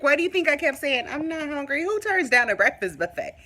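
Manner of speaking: playful disbelief at the friend who forgot; exasperated retort. Retold or imitated. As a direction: throw it back at her, incredulous.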